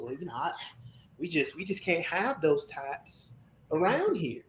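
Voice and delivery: put-on slow, sugary Southern accent. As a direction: mimic a polite Southern neighbor. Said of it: "'Cause when I'm not in a room, I guarantee you".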